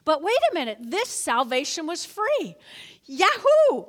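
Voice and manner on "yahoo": high, loud yahoo; joyful whoop. Acted. Pastor cheering over free salvation, high and loud. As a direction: let out a happy cheer.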